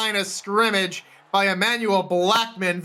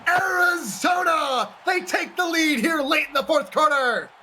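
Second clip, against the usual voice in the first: touchdown call; 9 semitones higher than usual. Acted. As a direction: roar it out as he breaks free. Touchdown run called live.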